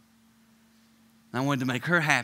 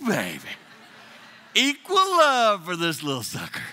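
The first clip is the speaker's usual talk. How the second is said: usual speaking voice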